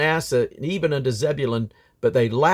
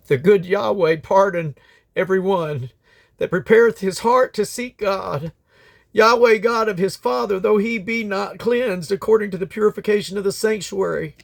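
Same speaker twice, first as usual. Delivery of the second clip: raised, fervent pleading to God; earnest prayer; 6 semitones higher than usual. A narrator's reading. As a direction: pray aloud for the unclean people, pitch lifted high. King Hezekiah prays for the people.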